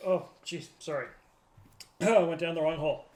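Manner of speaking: ordinary talk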